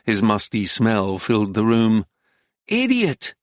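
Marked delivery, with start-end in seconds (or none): 2.7-3.3 high-pitched exasperated scolding; cross woman's high cry; raised pitch. A narrator's reading. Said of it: "raised the pitch of her voice".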